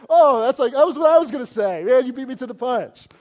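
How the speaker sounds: loud, high, hammed-up gushing thanks; mock cheery thanks. Crash victim sarcastically thanking the stranger, shouted and high. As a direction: ham it up as an overjoyed victim. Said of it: "You're not like".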